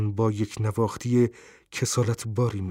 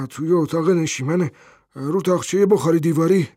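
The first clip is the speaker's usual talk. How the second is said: puzzled, higher-pitched reply; surprised; 5 semitones higher, 4 dB louder than usual. A narrator's reading. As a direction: answer as if taken aback. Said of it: "مرد با تعجب جواب داد". (با تعجب)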